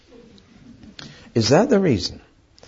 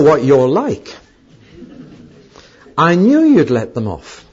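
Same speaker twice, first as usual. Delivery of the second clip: indignant I-told-you-so to God; accusing Jonah; 4 dB louder than usual. Retold or imitated. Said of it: "This is how he talked".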